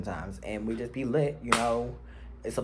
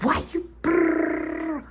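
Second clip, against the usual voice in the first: high, slow, disbelieving squeal; stunned sputter; much slower than usual. Retold or imitated. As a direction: sputter in shock.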